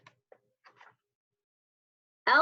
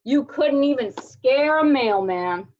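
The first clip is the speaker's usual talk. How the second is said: louder, sneering taunt; tough dog taunting; 5 dB louder than usual. A narrator's reading. Doberman taunts Alfred, loud and sneering. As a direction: jeer at the little dog.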